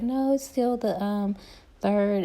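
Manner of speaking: high mock realtor. Realtor insisting on closing date, high mock voice. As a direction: brush it off, high. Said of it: "she was like".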